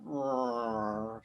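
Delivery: rolled catlike rrrr; cat growl imitation. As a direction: purr it like the resident cat. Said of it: "it's like".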